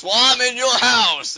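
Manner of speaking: loud, high, drawn-out comeback; shouted retort. Retold or imitated. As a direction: fire back at a rival in a slow mock shout. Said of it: "And Cena retorts".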